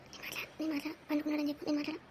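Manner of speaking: barely voiced, so the caller can't hear; whispered refusal. Son hissing refusal to mother. (చాలా మెల్లగా గుసగుస)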